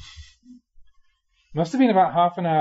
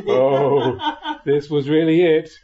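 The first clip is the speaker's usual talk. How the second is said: louder, laughing through the words; 6 dB louder, slower than usual. Real, not acted. Monk recalling his terror to listeners, laughing louder. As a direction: laugh as you admit how scared you were.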